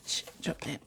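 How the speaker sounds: under-breath whisper after a fumble; whispered oops after dropping a card; whispered curse. Real, not acted. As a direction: hiss it aside to yourself. Dropping a card, cursing aside.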